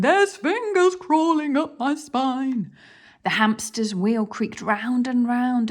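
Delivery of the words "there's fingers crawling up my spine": higher, louder, a touch of a whine; whining thief. Acted. Len feeling the mouse in his top, mildly whining. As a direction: whine like a spooked crook. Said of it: "lanky Len began to whine".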